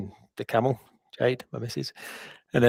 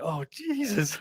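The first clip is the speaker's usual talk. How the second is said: high, hushed gasp of panic; a startled little yelp, caught out; frozen shock; 10 semitones higher, 5 dB quieter, slower than usual. Retold or imitated. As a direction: caught out in front of his hero. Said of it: "I froze and I was like".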